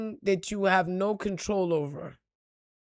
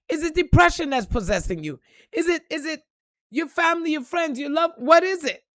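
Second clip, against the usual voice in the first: higher and louder, hammering questions at the crowd; fiery preaching; 6 semitones higher, 4 dB louder than usual. Real, not acted. Preacher on a live stream, grilling viewers, fiery and loud. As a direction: drive each question home like a preacher.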